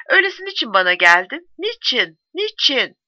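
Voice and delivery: high, insistent demand for answers; desperate protest. A narrator's reading. Young woman confronts the soldier she loves, desperate protest. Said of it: "umutsuz bir isyanla".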